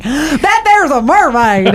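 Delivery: put-on gasping Papua New Guinea accent, slow; gasp, then a put-on Papua New Guinea accent. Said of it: "how do you do a Papua New Guinea accent".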